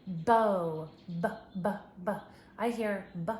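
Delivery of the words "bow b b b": "bow" louder, then repeated b sounds; sounding out. Shown as a demonstration. Teacher isolating the b sound for child, emphatic. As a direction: hit "bow" and tap out b, b, b. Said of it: "stretch it out".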